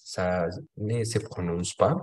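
ordinary talk, nothing marked

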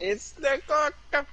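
high, slow sing-song name call; high silly voice. Pretend. Repeats pet's name playfully.

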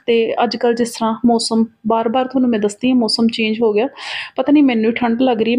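usual speaking voice

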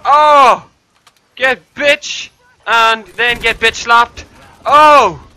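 high, loud triumphant shouting; excited gloating. Real, not acted. Player gloating over bitch-slapping an enemy, excited shouts. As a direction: crow it loudly at the enemy.

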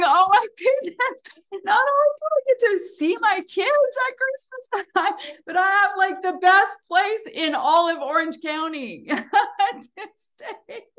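high, loud, excited gushing with a laugh; thrilled gushing. Retold or imitated. Speaker retelling her own joy at the free stay, high and excited. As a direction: gush with delight over the gift. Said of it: "And I'm going".

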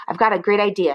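ordinary delivery

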